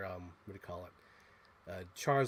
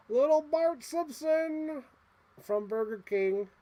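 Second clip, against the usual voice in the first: much higher, slow, cooing toy voice; cutesy voice; 13 semitones higher, 4 dB louder, slower than usual. Acted. Collector to a tiny toy figure, cooing high and slow. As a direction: croon his name like a cute little thing.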